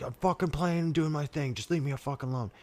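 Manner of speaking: high-pitched mock outburst; gets all mad. Husband snaps at wife about his game, mock mad. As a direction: imitate an angry husband without raising the volume. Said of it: "gets all mad".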